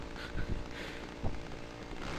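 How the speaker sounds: breathy nervous laugh. Real, not acted. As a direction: let out a shaky nervous laugh. Jump scare in a horror game.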